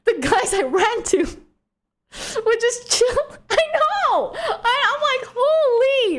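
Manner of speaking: very high, squealing excitement with laughs; amazed relief. Real, not acted. Amazed relief at her luck.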